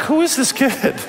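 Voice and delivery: high, laughing mock astonishment; incredulous grandpa cracking up at the kid; amazed laughing disbelief. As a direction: squeal it out through a laugh. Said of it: "I'm like".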